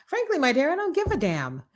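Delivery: high, sassy "Frankly, my dear" dismissal; mock-grand defiant put-down; defiant brush-off. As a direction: toss it off with diva sass. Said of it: "I'm like".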